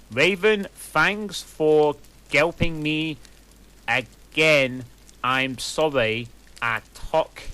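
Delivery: put-on high, loud ditzy teen voice, slowed; mock author's note. Reader mocks the fanfic author's note to Raven. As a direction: ham up the clueless teen author.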